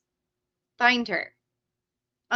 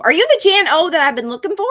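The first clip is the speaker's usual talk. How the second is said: fast, loud and higher, bubbling over; excited; 6 semitones higher, 9 dB louder, faster than usual. Real, not acted. Streamer hoping she found the winner, fast excited. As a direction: rush it out like you just found them.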